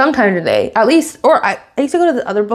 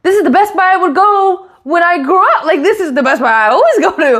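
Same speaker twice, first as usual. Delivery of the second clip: laughing explanation; 7 semitones higher, 3 dB louder than usual. Retold or imitated. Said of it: "I'm like".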